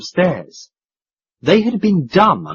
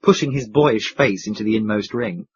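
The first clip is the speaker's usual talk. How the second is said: ordinary delivery, nothing marked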